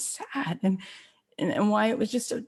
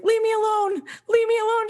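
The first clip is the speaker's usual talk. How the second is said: high, loud push-away; upset rebuff; 13 semitones higher, 5 dB louder than usual. Acted. Sister shutting out her sister in the movie, upset cry. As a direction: cry out to be left alone.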